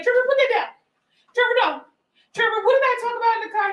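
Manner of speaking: high, pleading mom voice calling her son; high nagging mother, quickening; mom scolding. Retold or imitated. Mother in the store calling after her son, high and pleading. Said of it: "And she's just like".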